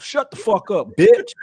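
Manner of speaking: high, playful shout rising at the end; hammed-up aggressive put-down; mock angry outburst. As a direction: yell it like a brawler mid-punch. Acts out the puncher's angry line.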